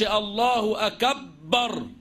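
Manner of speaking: الله أكبر with the ب of أكبر doubled, said very slowly; slow الله أكبر, ب geminated. Shown as a demonstration. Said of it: "ما يقولش".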